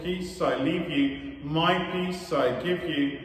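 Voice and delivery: normal talking voice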